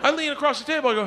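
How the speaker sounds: normal talking voice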